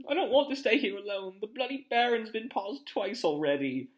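high, loud, fretful whine; fearful pleading. A narrator's reading. Neville fears being left alone, pleading.

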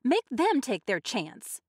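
slow, loud, high demand; yelling. A narrator's reading. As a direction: yell it, leaning on each word. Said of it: "Missus Hutchinson yelled".

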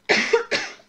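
sudden loud real cough; chesty cough. Real, not acted. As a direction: cough hard and high. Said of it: "Scusate la tosse".

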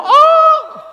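very high, loud yelped oh. Shown as a demonstration. Comedian shows a too-high oh, squealed loud. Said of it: "not one sister that went very high pitch".

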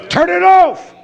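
loud, high order; sharp command. Real, not acted. Preacher telling fearful listeners to leave, loud command. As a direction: bark turn it off.

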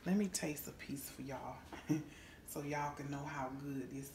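soft whisper before tasting. Real, not acted. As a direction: whisper as if sneaking a bite.

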